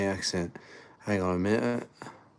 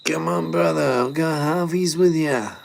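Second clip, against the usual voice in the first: put-on Ashay accent; 9 semitones higher, 4 dB louder than usual. Eshay offering to go halves, high mock eshay accent. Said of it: "speak in a bit of an Ashay accent".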